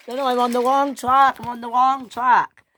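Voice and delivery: high, loud alarm; panicked. Acted. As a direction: cry out in panic at the mistake. Henry panics on the wrong track.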